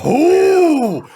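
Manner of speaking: high, loud excited ooh. Real, not acted. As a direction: squeal with delight at the roll. GM reacts to critical roll.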